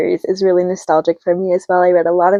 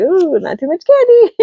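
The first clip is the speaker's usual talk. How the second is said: parent voice; 11 semitones higher, 4 dB louder than usual. Retold or imitated. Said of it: "And they're like".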